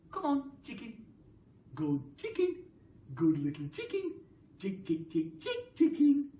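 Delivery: high sing-song hen call; coaxing the chicken. Acted. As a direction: sweet-talk a greedy hen closer.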